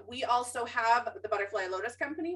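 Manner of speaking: ordinary talk, nothing marked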